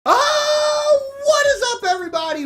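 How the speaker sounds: high, loud screamed hello; hyped greeting shout. Real, not acted. Youtuber greeting his viewers with a screaming hello. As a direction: blast the intro at full volume.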